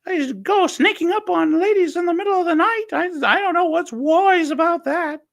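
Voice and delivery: very high falsetto nagging mother; high falsetto mother telling off the wise men; Brian's mum voice. Retold or imitated. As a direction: scold them like a cross mum in drag.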